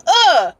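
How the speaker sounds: sharp high aggressive grunt; short fierce uh. Retold or imitated. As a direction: hit it like a fierce face. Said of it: "make an aggressive face".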